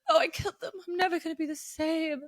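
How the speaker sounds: high-pitched whiny imitation; mock whining. Mimicking the guilty heroine after the killings, whiny. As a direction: whine it in mock self-pity. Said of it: "Very whiny. But she's like".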